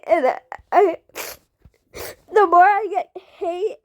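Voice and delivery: usual speaking voice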